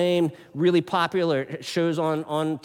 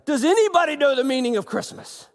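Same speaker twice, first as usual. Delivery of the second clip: higher and louder exasperated cry; frustrated Charlie Brown; 10 semitones higher, 5 dB louder than usual. Retold or imitated. Charlie Brown fed up with his friends, exasperated cry. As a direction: blurt it out in exasperation. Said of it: "he gets really frustrated".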